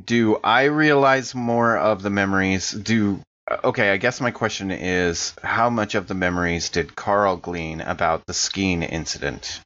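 ordinary talk, nothing marked

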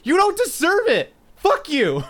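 high-pitched mock rant, shouting at the end; mock tantrum. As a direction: fake outrage, rising to a yelled insult. Mock outburst at Connor.

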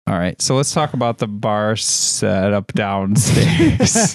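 slow, slurry talk thrown off by delay. Real, not acted. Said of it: "like, drunk".

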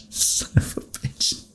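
whispering it under breath; hushed, defeated whisper; whispered curse. Real, not acted. Failed save, dismayed curse.

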